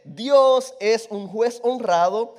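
slow, weighty reading; preacher reads Psalm seven slowly; slow scripture reading. Acted. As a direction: read each word slowly like a verse.

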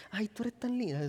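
soft, syrupy compliments; sweet-talking husband. Retold or imitated. Husband flattering wife to get fed, soft syrupy. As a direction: charm the wife softly. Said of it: "hablas a tu esposa con palabras".

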